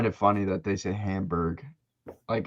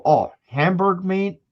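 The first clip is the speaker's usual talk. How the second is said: put-on higher, slow Southern grandparent accent; 8 semitones higher than usual. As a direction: stretch it out in a playful Southern accent. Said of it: "I'm giving them that accent".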